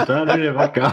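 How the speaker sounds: giggling through the words; laughing. Real, not acted. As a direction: speak while cracking up. Group laughing fit.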